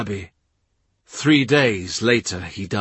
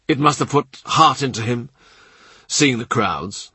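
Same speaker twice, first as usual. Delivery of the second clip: plain reading voice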